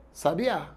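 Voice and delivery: sabiá, stressed on the final Á; stress on last syllable. Shown as a demonstration. Teacher contrasting same letters for students, stress on last syllable. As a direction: land on the end, sa-bi-Á. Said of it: "só vai saber se eu pronunciar".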